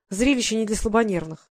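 ordinary delivery

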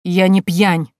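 loud, offended denial; indignant, stung outburst; angry and hurt. Acted. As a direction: protest with wounded pride. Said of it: "В гневном голосе дядюшки прозвучала обида".

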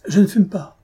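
lancé haut et fort; a quick high, loud reply; bright firm answer. Acted. Young wife answers brightly. (une réponse vive, haute et forte)

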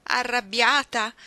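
as if the idea is absurd; incredulous. Acted. Ruth answering Iggy before hugging her, slow incredulous.

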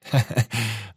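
brief acted laugh; amused chuckle. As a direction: chuckle at the family plan. Chuckling at the family plan.

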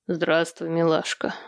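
lightly deepened thug's greeting; bass male voice. A narrator's reading. Gunman greeting a woman in her car, lightly bassy. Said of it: "пробасил голос где-то рядом".

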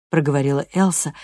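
ordinary delivery, nothing marked

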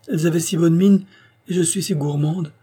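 put-on high, loud, girlish protest; excusing herself, pitched well up; flustered girl's excuse. A narrator's reading. Caught girl excuses her greed.

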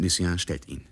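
ordinary talk, nothing marked

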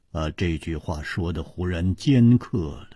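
ordinary delivery, nothing marked

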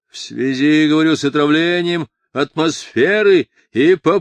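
put-on pompous drunken official holding forth; domineering drunk deputy, dictating. A narrator's reading. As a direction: declaim the legal wording over a noisy argument.

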